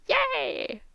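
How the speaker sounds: high, light "yay"; mock cheer. Reader voices a cheering character, high mock cheer. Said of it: "And he's like".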